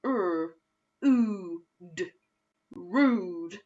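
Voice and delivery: "rude" with long oo; oo-way blend. Shown as a demonstration. Teacher modelling the oo sound for the class. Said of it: "Let's do that together".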